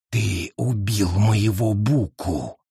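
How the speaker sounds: slow, low hiss; hissing witch. Acted. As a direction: hiss the accusation. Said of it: "прошипела ведьма".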